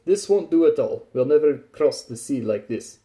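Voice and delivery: put-on voice of a grumbling sailor; Tachanka, disgruntled. A narrator's reading. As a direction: grumble loudly to himself in character.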